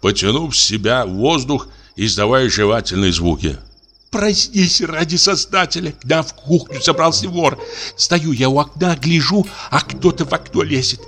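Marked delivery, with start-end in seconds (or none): 4.1-11.0 high, breathless alarm about a thief; a wife scared of a burglar, voice pitched high; frightened wife. Acted.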